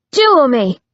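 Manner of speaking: loud barked challenge; angry demand. Acted. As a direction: hammer the demand home. Repeated challenge after the punch.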